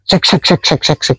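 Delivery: hissy, rhythmic "sek" in a quick string; imitated wingbeat noise. Shown as a demonstration. Explaining to Mas what the bird really does, imitated wingbeats. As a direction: mimic wings chopping through air.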